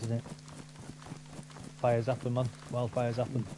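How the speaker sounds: ordinary delivery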